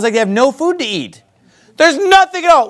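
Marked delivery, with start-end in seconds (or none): 1.8-2.7 high-pitched mock wail; hammed-up protest, voice pitched way up; crying complaint. Preacher repeats the Israelites' food complaint, mock crying. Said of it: "they're crying over their food".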